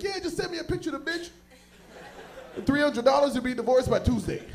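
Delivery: a sleazy cheap lawyer cackling at the deal; shady divorce hustler. Retold or imitated. Voicing a shady divorce guy.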